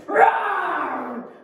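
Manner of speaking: loud, high shouted roar; lion roar. Shown as a demonstration. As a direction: roar like a hungry, angry lion. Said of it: "Can you all roar like lions for me?".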